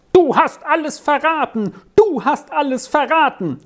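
put-on high-pitched angry boy's cry; furious boy shouting. A narrator's reading. Boy accusing another behind the ladies' backs, furious high cry. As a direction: hurl the accusation in a high boyish voice. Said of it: "schrie einmal über das andere eine in den lautesten Tönen wütende Bubenstimme".

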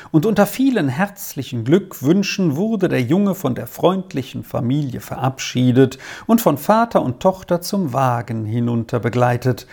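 plain reading voice

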